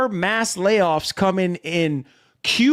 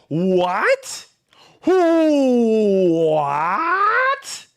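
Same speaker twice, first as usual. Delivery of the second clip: stunned disbelief. Real, not acted. Reacting to Xbox hardware news.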